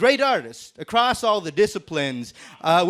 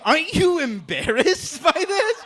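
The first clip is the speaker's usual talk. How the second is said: incredulous; 9 semitones higher than usual. Acted. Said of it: "I'm like".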